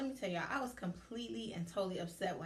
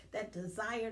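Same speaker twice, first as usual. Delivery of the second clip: ordinary delivery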